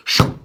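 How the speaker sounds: whooshing door noise; door whoosh sound. Acted. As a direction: make the door swoosh. Sound effect of door slamming.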